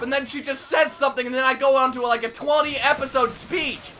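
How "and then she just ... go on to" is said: normal talking voice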